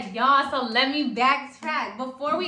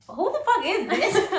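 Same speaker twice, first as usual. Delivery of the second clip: high-pitched, laughing mock disbelief; irritated; 8 semitones higher than usual. Friend reacting to an ugly girl's picture, mock irritated. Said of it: "like, irritated".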